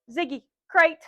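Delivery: loud, firm call to the dog; loud dog command. Real, not acted.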